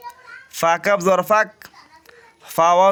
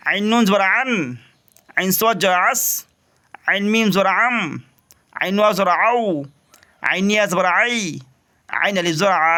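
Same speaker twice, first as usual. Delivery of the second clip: teacher chanting the letter drill; spelling drill; 3 semitones higher than usual. Shown as a demonstration. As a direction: sound out each syllable for students. Teacher drills fourth line.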